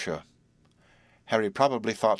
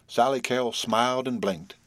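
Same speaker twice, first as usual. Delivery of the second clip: plain reading voice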